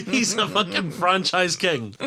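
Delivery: laughing through the words; slow, laughing delivery. Real, not acted. Laughing while mocking Vin Diesel.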